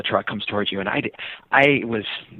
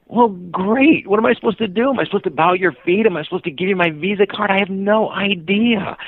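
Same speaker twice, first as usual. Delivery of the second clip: high-pitched run of helpless questions; flustered exasperation; 10 semitones higher, 3 dB louder than usual. Retold or imitated. Cyclist at a loss before boy deity, mock flustered. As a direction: pile up the questions high, then stretch the last line.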